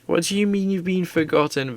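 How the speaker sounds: ordinary talk, nothing marked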